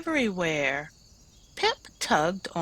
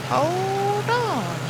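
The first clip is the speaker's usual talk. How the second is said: Mister Miller; 12 semitones higher, 3 dB louder than usual. Acted. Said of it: "he said".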